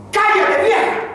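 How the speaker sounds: high, full-volume shout; explosive, high-pitched yell; acted yell. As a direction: yell it as a rude daughter silencing her mother. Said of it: "está gritando".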